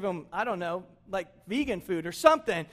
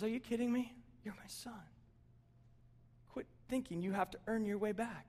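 hushed fatherly reassurance; 12 dB quieter than usual. Acted. God answering his returning son, hushed reassurance.

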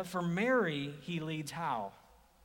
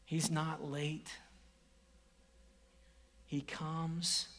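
ordinary talk

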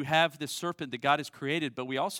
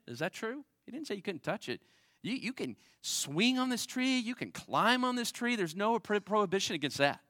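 animated correction; 7 semitones higher than usual. Real, not acted. Correcting Eve's added prohibition.

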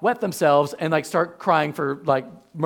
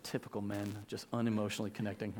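muttered aside; 9 semitones lower, 17 dB quieter, faster than usual. Retold or imitated. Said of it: "Some of you are like".

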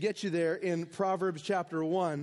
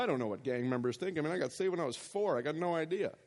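mock naive sheltered father; 4 semitones lower than usual. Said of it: "he's not sitting there going".

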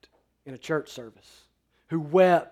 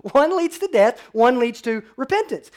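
louder and higher, hammering the contrast; fervent preaching; 9 semitones higher, 8 dB louder than usual. Acted. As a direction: preach "One leads to death" out to the room. Preacher repeats contrast at full force.

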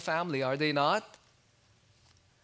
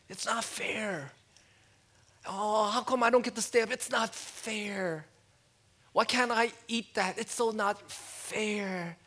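put-on higher pitched whining child, dragging it out; whiny kid; 5 semitones higher than usual. Preacher mimicking a kid who wants to stay up, whiny and higher. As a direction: mimic a child complaining at bedtime. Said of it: "they learn the word fair".

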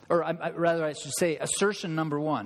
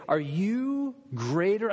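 high, slow, mocking challenge; puffed-up Pharisee; 5 semitones higher, slower than usual. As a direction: sneer it like a proud Pharisee, chest out. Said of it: "chests all puffed out".

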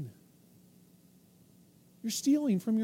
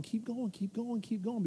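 Satan luring softly; 7 dB quieter than usual. Acted. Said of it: "Satan's just stringing you along".

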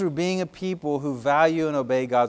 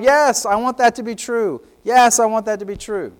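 congregation affirming aloud; 6 semitones higher, 3 dB louder than usual. Retold or imitated. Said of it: "you're saying out loud".

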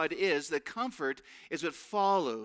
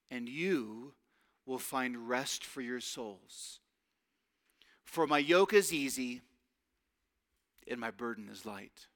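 gentle reading; 6 semitones lower than usual. Acted. Reading Jesus' words gently.

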